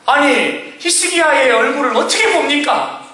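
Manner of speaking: high, pleading objection; mild protest. Acted. Preacher as reluctant prophet answering God, protesting. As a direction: argue back at God with a touch of exasperation.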